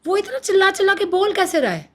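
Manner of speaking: high, indignant disbelief; outraged disbelief. Real, not acted. Outraged by the shouting father-in-law, indignant disbelief. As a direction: voice climbs in outrage.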